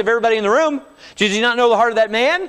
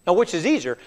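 ordinary delivery

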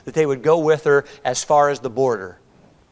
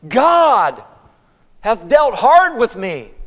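high, slow, loud lament; bitter accusation; 5 semitones higher, 5 dB louder, much slower than usual. Acted. Naomi blames God for her hard lot, bitterly. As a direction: draw out the blame, pitched high.

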